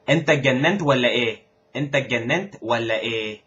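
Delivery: Egyptian Arabic with a hard G in ganent; Egyptian Arabic phrase. Shown as a demonstration. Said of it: "the first sentence I've got is". (say it the Egyptian way, hard G)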